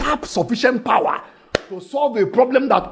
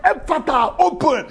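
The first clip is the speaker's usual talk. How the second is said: commanding cry; 8 semitones higher than usual. Acted. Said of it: "Once he said".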